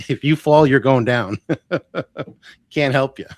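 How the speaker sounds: loud, laughing joke warning. Real, not acted. Telling clients he can't catch them, loud and laughing. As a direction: laugh through the mock warning.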